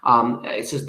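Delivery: ordinary talk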